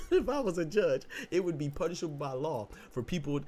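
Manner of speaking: high laughing mock ruling; giggling mock judge. Real, not acted.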